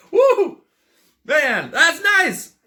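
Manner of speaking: high, loud laughing woo after a sip; delighted whoop. Real, not acted. Tasting mead on camera, a loud happy whoop. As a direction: whoop with pleasure at the taste.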